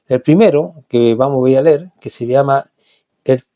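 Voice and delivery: usual speaking voice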